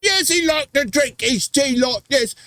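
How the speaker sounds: very high, hammed-up British caricature; silly high-pitched British tea drinker; mock British accent. Joking about a British actor drinking tea, mock British accent. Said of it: "He's British dude. That governor".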